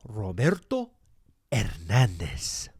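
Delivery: Roberto Hernandez rolled in full Spanish, Hernandez drawn out slow; Spanish pronunciation. Shown as a demonstration. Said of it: "that's how I say my name".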